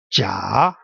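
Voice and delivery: Jia dipping low, then rising; low scooped Jia with an upturned end; third tone. Shown as a demonstration. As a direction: take Jia down deep and bring it back up. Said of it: "Going down and then going up".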